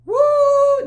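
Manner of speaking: long, high-pitched "woo"; high loud whoop. Real, not acted. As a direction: let out a big, loud whoop. Whooping in reaction to retold story.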